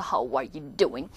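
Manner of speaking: low, drawn-out American "How are you doing?"; American accent. Shown as a demonstration. Teacher mimicking gesturing Americans for students, American accent. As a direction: say it slowly with an American lilt. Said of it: "막 제스처 쓰면서".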